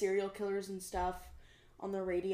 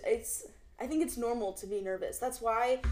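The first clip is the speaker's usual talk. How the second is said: ordinary talk, nothing marked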